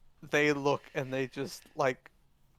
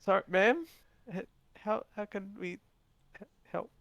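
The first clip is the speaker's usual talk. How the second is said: meek; 7 semitones higher than usual. Acted. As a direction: stumble timidly through the offer of help. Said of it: "meekly comes up and goes".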